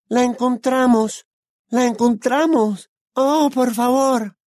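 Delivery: very high, drawn-out wail; acted anguished cry; desperate pleading. A narrator's reading. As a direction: beg under torture in a stretched high voice. Tortured captive pleads.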